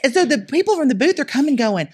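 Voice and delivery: ordinary talk, nothing marked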